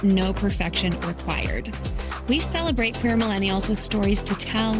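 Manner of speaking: ordinary talk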